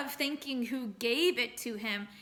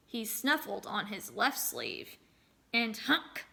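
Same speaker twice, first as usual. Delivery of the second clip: storyteller reading aloud to a class; story narration. A narrator's reading. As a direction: tell the folktale to the children.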